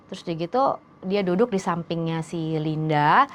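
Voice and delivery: ordinary talk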